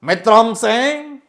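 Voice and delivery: high, loud, very slow Hindi oration; Hindi politician impression. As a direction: drag out each word like a national leader. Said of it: "politicians తీసుకొద్దాం".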